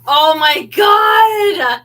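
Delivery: loud, drawn-out squeal of delight; excited shout. Real, not acted. Finding a jacket for Joe, a loud, slow, thrilled outcry. Excited find while thrifting.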